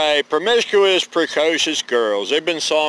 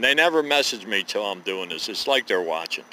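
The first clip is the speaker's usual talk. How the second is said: ordinary talk, nothing marked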